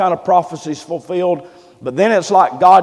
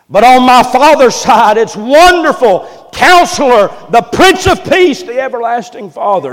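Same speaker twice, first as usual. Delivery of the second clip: loud, raised preacher's declaration; booming proclamation; 5 semitones higher, 6 dB louder than usual. Acted. Boy Jesus answering the doctors about his Father, booming. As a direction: proclaim each title at high volume.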